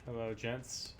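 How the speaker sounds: ordinary delivery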